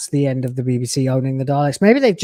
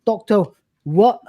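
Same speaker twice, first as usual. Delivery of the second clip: higher, hammed-up Cockney reassurance; calm her down like a Cockney geezer; Cockney bloke; 7 semitones higher than usual. Cockney Doctor calms his panicking assistant. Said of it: "some Cockney bloke".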